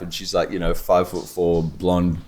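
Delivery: normal talking voice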